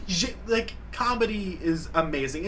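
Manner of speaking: ordinary talk, nothing marked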